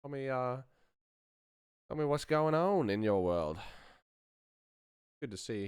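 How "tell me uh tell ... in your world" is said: ordinary talk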